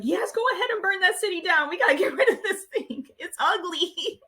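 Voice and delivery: high, laughing mock yell; retold yelling. Recalls cheering on the burning city, mock yelling. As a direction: squeal it as a playful outraged cheer, dragging the end. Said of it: "I remember yelling".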